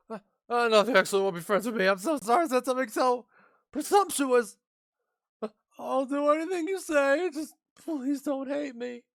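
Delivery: put-on a shy girl giddy to have friends at last; timid nurse girl voice. Mikan voice again, pleading.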